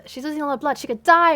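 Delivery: half-panicked yell. Acted. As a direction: shout the warning. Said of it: "Rick yelled".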